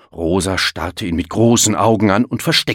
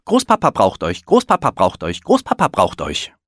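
a lightly voiced bird, a bit higher; little bird calling; 3 semitones higher, 3 dB louder than usual. A narrator's reading. The little bird calls Elmar and Willi to grandpa, lightly higher. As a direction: chirp the message three times over. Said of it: "kam ein Vögelchen angeflogen".

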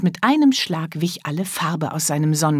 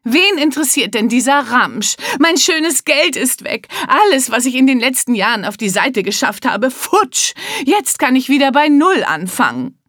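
louder, higher furious lament over lost money; angry rant; 6 semitones higher, 3 dB louder than usual. Acted. Father snapping at his daughter over the lost money. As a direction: rage at the trivial question. Mister Hilarious rants at daughter.

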